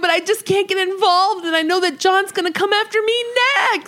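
hammed-up panicked rant, words tumbling out; screaming out in panic. Frightened woman confessing to her friend, acted high-pitched panic. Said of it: "and then Joe screams out".

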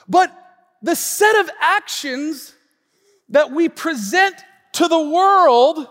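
high, loud, slow sermon delivery; forceful preaching. Real, not acted. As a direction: drive the point home to a congregation.

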